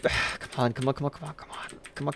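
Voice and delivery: breathy whispered urging; tense whisper. Real, not acted. Streamer urges the pieces to fit in a hushed, tense whisper. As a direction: hold your breath and urge it on.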